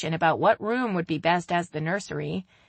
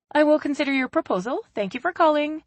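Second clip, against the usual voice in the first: polite; 10 semitones higher, 4 dB louder, faster than usual. A narrator's reading. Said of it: "Haley said politely".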